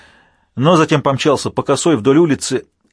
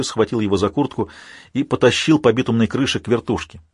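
ordinary delivery

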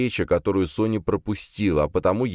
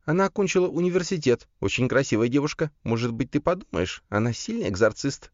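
high female character voice; Карина; 9 semitones higher than usual. A narrator's reading. Sister selling a bride to her brother, high coaxing voice.

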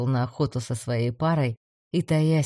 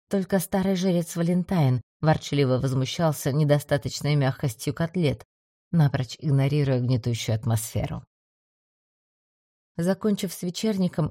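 usual speaking voice

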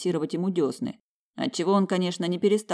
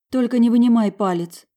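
higher, louder urgent pleading; 8 semitones higher, 9 dB louder than usual. A narrator's reading. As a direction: beg him not to stop. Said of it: "взмолилась она".

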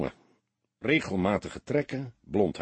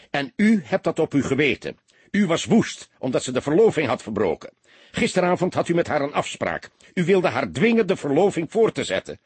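louder, higher, harsh accusing voice; hard accusation; 6 semitones higher, 4 dB louder than usual. A narrator's reading. Detective hardly accusing the soldier of strangling her. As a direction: hammer the charge home. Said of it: "Zijn stem klonk hard".

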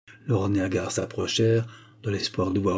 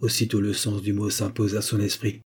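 usual speaking voice

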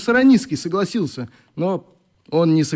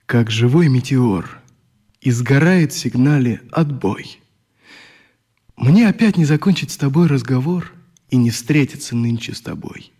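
poem reading. Acted. Oleg reading his own poem to the boys, earnest. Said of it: "Вот эти строчки".

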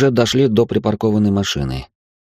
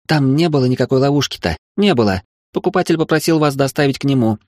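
higher woman's voice; 7 semitones higher than usual. A narrator's reading. Florist protests, defending herself.

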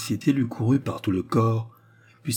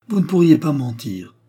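raised, louder protest; exclaiming; 7 semitones higher, 6 dB louder than usual. A narrator's reading. Antoine protesting to Raymonde, exclaiming. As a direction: cry out he can't believe she'd lie. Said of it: "s'écria-t-il".

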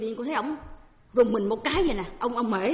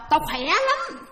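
higher, louder mock exclamation; delighted retold reply; 7 semitones higher, 5 dB louder, much slower than usual. Man answers how he feels, acted delighted. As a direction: exclaim it as the man feeling great.

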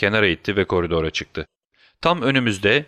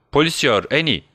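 raised, higher-pitched call; a restrained shout for help; 6 semitones higher, 3 dB louder than usual. A narrator's reading. Man calls his wife to fetch police, shouting. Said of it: "diye bağırdı".

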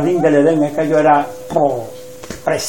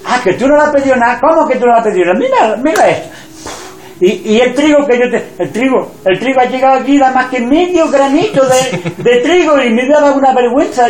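higher, fast teasing disbelief; incredulous wife; 6 semitones higher, faster than usual. Acted. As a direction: scold him playfully for not asking. (esposa incrédula y burlona, más aguda y rápida)

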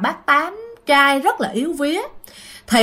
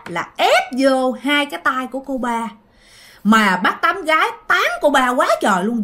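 usual speaking voice

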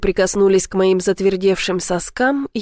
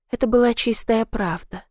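ordinary delivery, nothing marked

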